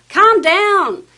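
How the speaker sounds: high, loud shouted command; angry yell. Shown as a demonstration. Staff member shouting at an angry customer, loud. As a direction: bark it at them, losing patience. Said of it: "it would be tempting to yell".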